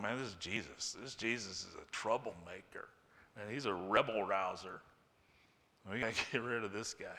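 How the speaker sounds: low, quiet plotting voice; conspiring mutter. Acted. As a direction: grumble it like schemers in a corner. Pharisees plotting, voiced by preacher.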